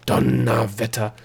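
savoured, awed exclamation; impressed. A narrator's reading. Girl hearing about the gnome's time-reckoning, impressed. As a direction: let the word out in admiration. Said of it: "sagte Delia beeindruckt".

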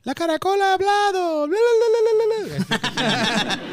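very high, slow babbling bla bla; SpongeBob-style squeak. Retold or imitated. Imitating the SpongeBob magic conch scene in a squeaky voice. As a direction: squeal it like a cartoon character. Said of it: "le preguntan cosas a una, un caracol".

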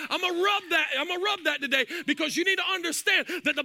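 rising, shouted preaching over music; full-force preaching. Acted.

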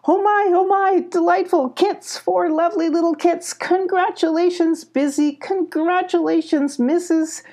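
high-pitched delighted gushing; delighted congratulations. A narrator's reading. Mama Owl sees the four new kits, delighted. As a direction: gush over the babies, pitched high throughout.

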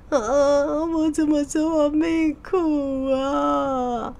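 long, slow, high-pitched wail; mock weeping. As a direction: wail it out in drawn-out self-pity. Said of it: "听到有人在哭哎". (拖长声音、夸张地哭诉)

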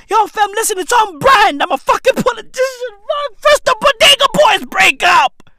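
high-pitched, shouted rant; mock meltdown. Comedian upset over Bodega Boys breakup, mock meltdown. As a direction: build into a loud, high wail of outrage.

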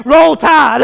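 loud Roll Tide shout; rival cheer. Retold or imitated. Alabama fan shouting at him, loud taunt. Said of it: "and they say".

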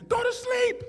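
high-pitched mock mother's order; mom's command. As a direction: play mom calling out at bedtime. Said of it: "My mom says".

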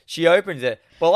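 ordinary talk

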